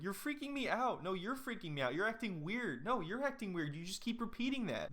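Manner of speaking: high, bickering voices; high mock tit-for-tat squabble. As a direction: act out a childish back-and-forth. Imagined back-and-forth quarrel, high.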